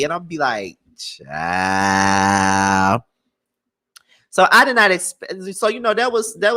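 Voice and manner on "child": low, long drawn-out drawl; unimpressed reaction. Retold or imitated. Answering people who call the speaker country, low drawled reaction. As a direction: sink low and stretch the word out. Her drawn-out reply to gushing fans.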